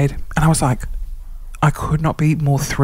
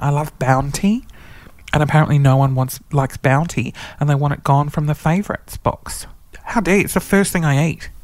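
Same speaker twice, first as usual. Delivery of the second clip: normal talking voice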